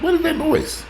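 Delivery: hammed-up alarm at a mystery noise; startled retold exclamation. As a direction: squeak it out like you just heard a strange crunch. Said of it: "I'm like".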